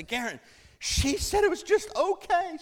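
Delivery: very high, whiny cry of injured pride; hammed-up wail of wounded indignation; mock outraged wail. Young preacher complaining to wife about sermon verdict, wailing.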